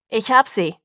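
loud booming announcement to the search party; booming call. A narrator's reading. Sam tells the searchers he found Bella, booming call. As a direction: boom it out so the whole group hears. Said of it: "rief er dröhnend".

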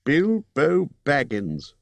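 高めの声でゆっくり威厳ある呼びかけ; 敬意をこめて名を呼ぶエルフの王; Elvenking, slow and stately. Acted. Elvenking voiced in story.

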